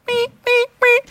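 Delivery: squeaky siren wees; playful alarm sound; high car alarm wee. Retold or imitated. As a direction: mimic a far-off alarm in a high voice.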